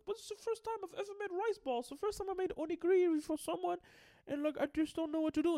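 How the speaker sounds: put-on high, pleading little-girl voice; high mock little girl, hurt and pleading. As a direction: squeak it out like an upset little girl. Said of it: "she was like".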